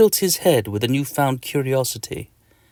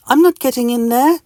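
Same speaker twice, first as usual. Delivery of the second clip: high, loud little refusal; stubborn tiny voice; 12 semitones higher, 6 dB louder than usual. Acted. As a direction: dig in like a defiant mite. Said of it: "says Mister Itch as he crosses his arms".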